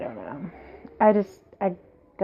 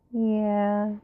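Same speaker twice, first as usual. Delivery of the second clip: ordinary talk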